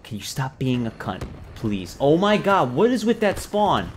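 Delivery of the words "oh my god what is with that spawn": loud, raised-pitch complaint; fed-up gamer yelling at the game; exasperated outburst. Real, not acted. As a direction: complain loudly in real frustration. Angry at enemy spawn.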